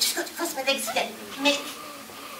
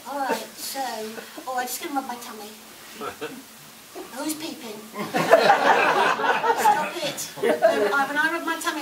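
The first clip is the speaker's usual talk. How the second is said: ordinary talk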